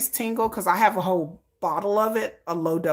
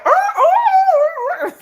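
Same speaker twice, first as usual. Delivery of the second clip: flustered squeal; 9 dB louder, much slower than usual. Retold or imitated. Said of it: "I'm like".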